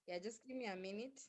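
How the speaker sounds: barely audible, far from the mic; weak, distant voice on a bad line; faint voice. Real, not acted. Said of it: "you're very faint".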